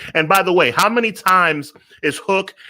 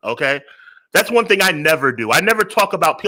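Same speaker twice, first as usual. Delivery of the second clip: ordinary talk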